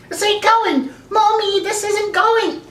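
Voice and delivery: put-on high, whining little-kid voice; hammed-up childish distress; upset child. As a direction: complain to Mommy in a put-on high voice. Child complaining to Mommy.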